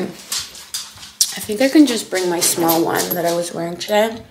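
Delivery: loud, chewing while talking; chewing gum. Real, not acted. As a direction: talk through a mouthful of gum. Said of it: "Sorry for the gum chewing".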